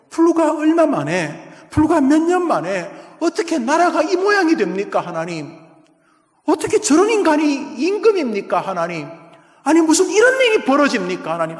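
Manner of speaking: high, loud crying out to God; anguished outcry. Acted. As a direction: plead with God at the top of your voice. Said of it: "하박국이 절규합니다".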